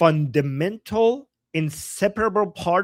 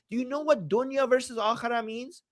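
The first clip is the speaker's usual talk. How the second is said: ordinary talk, nothing marked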